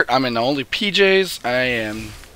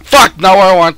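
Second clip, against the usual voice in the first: frustrated outburst; 6 semitones higher, 8 dB louder than usual. Real, not acted.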